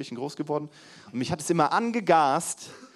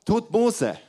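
preaching Jesus; 8 semitones higher, 5 dB louder than usual. Retold or imitated. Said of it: "was hat er davor immer gesagt".

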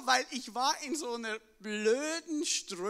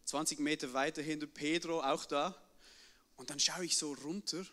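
ordinary delivery

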